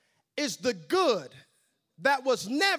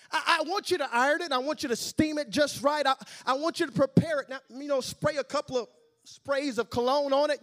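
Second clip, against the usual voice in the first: eager instructions; 5 semitones higher, 5 dB louder than usual. Acted. Haman fussily gives preparation orders.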